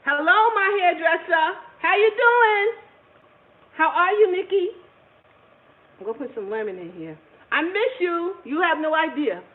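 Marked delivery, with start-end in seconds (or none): 0.1-4.7 delighted greeting. Real, not acted. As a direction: call out her joy, pitch up. Greeting her hairdresser on stream.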